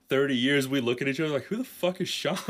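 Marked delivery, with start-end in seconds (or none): 1.4-2.4 high-pitched incredulous question; hammed-up disbelief; mock bafflement. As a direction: act totally baffled, voice pitched up. Hosts baffled by forgotten name.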